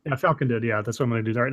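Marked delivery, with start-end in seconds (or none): none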